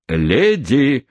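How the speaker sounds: a touch of desperate shouting; desperate cry. A narrator's reading. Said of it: "закричал мистер Пиквик".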